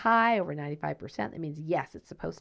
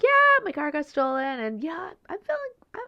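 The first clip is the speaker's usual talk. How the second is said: high, bright voice, louder at first; happy mentee; 13 semitones higher, 7 dB louder than usual. Retold or imitated. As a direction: sound cheerfully unbothered by a stolen car. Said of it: "He's like".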